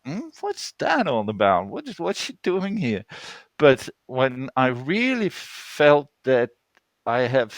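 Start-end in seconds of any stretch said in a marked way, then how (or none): 0.1-3.0 a hint of baffled students wondering; puzzled students. Teacher mimics her puzzled students, mildly baffled. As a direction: lightly mimic puzzled pupils. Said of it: "sitting a little bit like".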